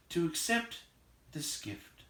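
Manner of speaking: faltering, fading hobbit voice; nervous stammer. Acted. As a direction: trail off shyly into a whisper.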